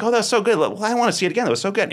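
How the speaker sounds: high-pitched gushing; acted delight. Acting out delighted replay of triumph.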